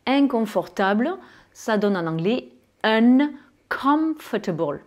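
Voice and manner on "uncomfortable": "uncomfortable" slowly, holding the N and M; sounded N and M. Shown as a demonstration. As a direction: draw out "uncomfortable" with N and M fully sounded. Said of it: "J'ai bien prononcé le N et le M". (« uncomfortable » lentement, N et M bien prononcés)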